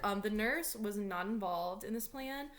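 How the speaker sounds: ordinary delivery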